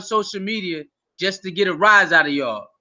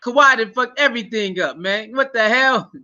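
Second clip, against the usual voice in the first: ordinary talk, nothing marked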